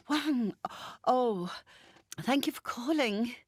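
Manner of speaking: Ray relieved. Acted. Ray relieved Wang calls.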